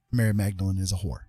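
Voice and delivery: ordinary talk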